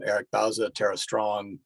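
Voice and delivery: ordinary talk, nothing marked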